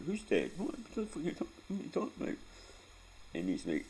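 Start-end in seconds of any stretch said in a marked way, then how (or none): 0.1-2.4 much higher, shrill-edged disbelief; confused little brother. Retold or imitated. Retelling his brother Scott waking up, high confused disbelief. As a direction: play the bewildered kid brother.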